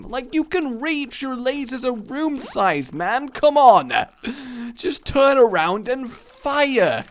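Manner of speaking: high, whining exasperation; exasperated pleading. Real, not acted. Player urging Robo Baby to fire, exasperated. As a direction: plead in high-pitched frustration.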